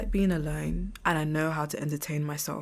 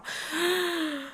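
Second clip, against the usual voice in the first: high startled mock gasp; a sharp intake of mock horror; 13 semitones higher than usual. Reacting to the imagined sergeant with a mock gasp.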